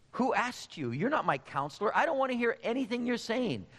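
mildly acted indignant dismissal; indignant rebuff. Acts out king rebuffing the prophet.

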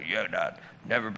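deep fake-serious promise; son sounding like an old dude. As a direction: imitate the boy playing a grave old man. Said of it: "Talking like he's an old dude".

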